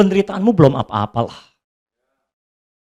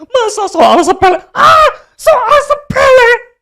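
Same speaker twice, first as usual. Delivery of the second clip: high, loud, slow hammed-up wail; mock wailing; 5 dB louder, slower than usual. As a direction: parody a crybaby shouting. Parody crying shout. (ratapan berlebihan)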